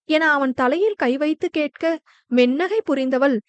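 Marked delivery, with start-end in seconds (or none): none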